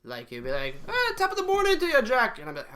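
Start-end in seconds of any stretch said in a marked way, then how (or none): 0.9-2.4 high, loud, fast stage-Irish; mock Irish accent. Comic imitating classmate on Ireland trip, exaggerated Irish accent. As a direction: put on a squealing cartoon Irish accent. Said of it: "putting on this Irish accent".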